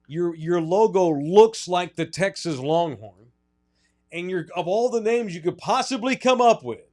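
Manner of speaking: emphatic rant. Real, not acted. Host ranting about the Texans' name and logo, emphatic.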